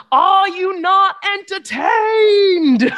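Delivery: booming, high, drawn-out movie shout; hammed-up gladiator roar; Russell Crowe impression. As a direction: bellow it slowly to a whole arena. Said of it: "do it in the voice".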